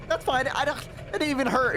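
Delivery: high, rushed mock-brave whimper; holding back tears, acted. Elf who face planted to onlookers, fighting tears in mock bravado. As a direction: insist you're okay, voice squeezed up high. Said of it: "very obviously trying to hold back tears".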